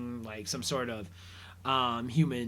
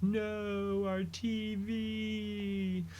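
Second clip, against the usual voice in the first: mock dismay; 8 semitones higher, 4 dB quieter, much slower than usual. Turtles see their TV smashed.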